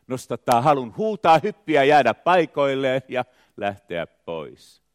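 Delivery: high, loud surge in a poem recital; impassioned outburst. Acted. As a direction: let the verse swell into a near shout. Poem swells at urge to shout.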